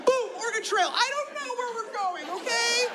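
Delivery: high, frantic flustered outburst; high flustered yelp; flustered passenger. Retold or imitated. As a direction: panic as the seatbelt locks. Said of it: "you're like".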